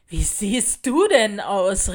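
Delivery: loud, drawn-out taunt; loud jeering call. A narrator's reading. Older kids taunt the new girl, calling loudly. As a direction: call it out mockingly, stretching the words. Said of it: "riefen sie laut".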